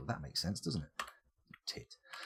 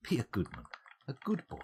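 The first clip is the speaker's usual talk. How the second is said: coaxing; 4 semitones higher, 4 dB quieter, faster than usual. Pretend. Coaxing a dwarf like a pet.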